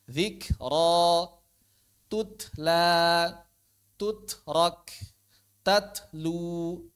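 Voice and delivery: "Zikra, tutla" with air after k and t; measured drill, "tutrak" split by a puff of breath; breath on kaf and ta. Shown as a demonstration. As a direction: aspirate the stopped t in "tatlu", no vowel. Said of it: "ya begitu".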